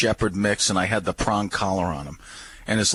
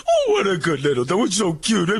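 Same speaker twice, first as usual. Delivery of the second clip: mock gushing dog lover; 8 semitones higher than usual. Park stranger gushing over someone's dog, mock gushing. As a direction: imitate an idiot fussing over a puppy. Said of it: "just acting like an idiot".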